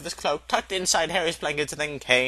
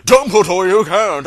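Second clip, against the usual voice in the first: protesting; 6 semitones higher, 10 dB louder than usual. Acted. McGonagall protests to Dumbledore.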